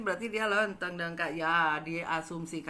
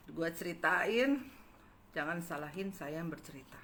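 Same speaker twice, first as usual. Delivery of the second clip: usual speaking voice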